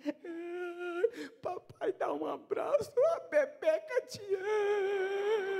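put-on high, slow, sweet little-girl voice with a laugh; imitating little girl. Daughter wheedling her father to escape discipline, imitated little-girl voice. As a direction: coax daddy like a small daughter.

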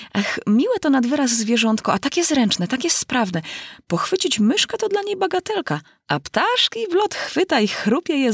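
high, eager praise; gushing. A narrator's reading. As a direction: rave about her pet. Girl gushing about her cat.

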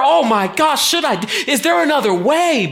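anxious Jesus. Retold or imitated. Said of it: "he felt the not sure".

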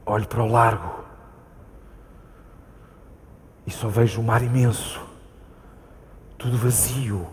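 low, soft, slow lines, staring at the empty sea; quiet haunted monologue; hushed slow narration. Acted. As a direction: let each image hang in silence.

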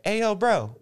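high, loud mock bro greeting; excited "hey yo bro" in a high bro voice. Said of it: "he's like".

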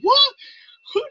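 high startled who-what; surprised. Retold or imitated. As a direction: squeak of sudden confusion. Coworker hears [name].

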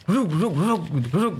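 quick mimicked thuds; spoken sound effect of a commotion; imitated rumble. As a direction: mimic a clattering bang next door. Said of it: "ada ini, ada bunyi".

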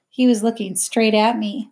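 ordinary delivery

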